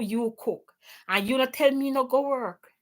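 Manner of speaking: usual speaking voice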